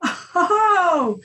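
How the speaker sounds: loud, high gasp of shock; reliving the shock, loud and high. Retold or imitated. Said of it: "my reaction was".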